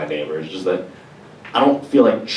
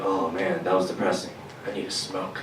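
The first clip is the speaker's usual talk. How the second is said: glum mutter; 11 dB quieter than usual. Retold or imitated. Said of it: "I'm just like".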